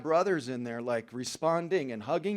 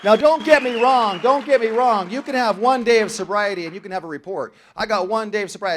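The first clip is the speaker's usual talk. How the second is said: loud and higher, driving the point home; emphatic preaching; 4 semitones higher, 8 dB louder than usual. Real, not acted. Speaker preaching to the meeting, loud and high. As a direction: hammer it out to the whole room.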